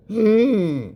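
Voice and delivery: high put-on worried hum; mocking the scammer's unease. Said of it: "very concerned response".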